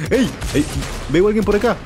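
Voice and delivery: loud, high excited callout. Real, not acted. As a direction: call it out like you spotted someone. Calling out a spotted enemy. (aviso emocionado)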